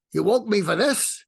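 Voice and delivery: put-on a fed-up old New Yorker from Brooklyn, pushing him away; annoyed Brooklyn-accented mentor grumbling at being woken; Larry King's Brooklyn accent. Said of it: "in his Brooklyn accent".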